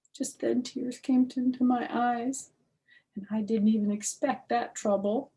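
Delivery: plain reading voice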